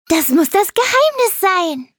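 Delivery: hoch und lauter gerufen; aufgeregter Ruf. A narrator's reading. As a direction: triumphierend ausrufen. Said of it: "rief Leonardo". (aufgeregt rufend)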